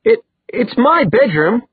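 high, slow, flustered stammer; stumbling, high-pitched indignation; spluttering. A narrator's reading. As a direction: splutter it in shocked protest. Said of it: "Alex spluttered".

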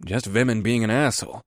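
ordinary delivery

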